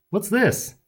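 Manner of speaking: high, puzzled question; puzzled baker. Acted. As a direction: ask suspiciously.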